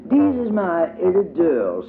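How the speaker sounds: put-on high, slow, lisping little toddler; small and careful like the youngest child; tiny child voice for Tot. A narrator's reading. Tot shows her doll to Diddy, high slow toddler voice.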